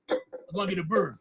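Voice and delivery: ordinary delivery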